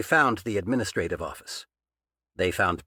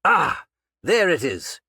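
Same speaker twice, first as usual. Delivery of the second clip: loud, higher cry of triumph; cried out; 6 semitones higher, 6 dB louder than usual. A narrator's reading. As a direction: cry out as the pipe gives. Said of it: "Patchen cried out".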